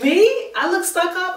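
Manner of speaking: can't believe she'd think that; shocked disbelief. Retold or imitated. Shocked at being called stuck-up.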